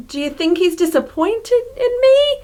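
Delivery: high, fretful question climbing at the end; worried inner voice. Acted. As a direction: act out anxious self-doubt. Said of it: "someone's internal voice being like".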